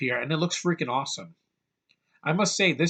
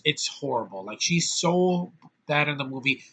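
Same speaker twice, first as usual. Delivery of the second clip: usual speaking voice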